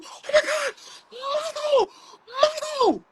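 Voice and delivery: very high mock fan cries, swooning; screaming fans. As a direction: cry out like an overwhelmed concert fan. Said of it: "taking off his glasses and people".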